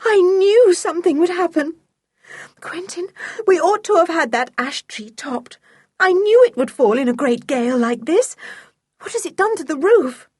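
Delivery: high, upset woman's voice; agitated aunt fretting after a disaster; distraught. A narrator's reading. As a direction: lament it in high-pitched dismay. Aunt distressed after the tree crash.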